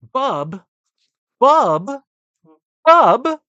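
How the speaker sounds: from a normal call to a full yell; building call. Real, not acted. Calling out louder each time.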